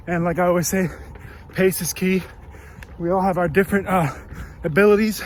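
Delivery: talking mid-run, loud and breathless; speaking while jogging; while running. Real, not acted. Recording mid-run, third leg.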